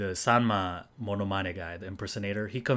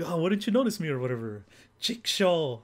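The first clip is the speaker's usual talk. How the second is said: higher, pleading "why didn't you notice me"; mock whiny complaint; 7 semitones higher, 6 dB louder than usual. Sugai's story punchline, high and pouty. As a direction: play it hurt and cute. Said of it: "she does it, like".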